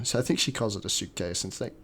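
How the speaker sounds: ordinary talk, nothing marked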